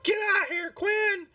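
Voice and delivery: high-pitched call to her son; mother yelling out. Acted. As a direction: holler for the kid without much real volume. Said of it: "And she yells out".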